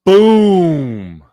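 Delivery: loud boom. Real, not acted. Streamer calling the finishing blow on the replay, loud. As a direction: slam the word down like the punch.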